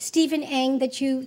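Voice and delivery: ordinary talk, nothing marked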